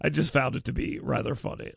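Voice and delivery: usual speaking voice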